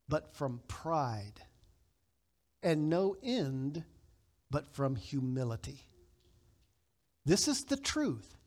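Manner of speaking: slow, hushed, weighty reading; solemn quotation. Acted. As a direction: let every phrase sink in slowly. Reads Murray quote solemnly.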